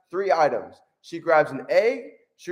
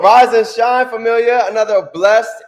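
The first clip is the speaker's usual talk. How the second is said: loud, high-energy wake-up call; loud excited morning greeting; hyped greeting; 5 semitones higher, 9 dB louder than usual. Real, not acted. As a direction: pump up the room.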